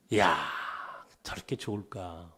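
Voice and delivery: low, hushed wonder; wistful marvelling. Retold or imitated. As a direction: murmur it softly to yourself, a little envious. Voices his wistful envy watching them. (부러운 듯 낮고 조용하게)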